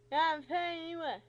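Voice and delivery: loud, high mush of words; unintelligible doctor. Retold or imitated. Patient mimicking the doctor she can't understand, garbled and loud. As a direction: imitate incomprehensible speech, loudly. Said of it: "I can't hardly understand him".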